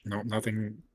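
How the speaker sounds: usual speaking voice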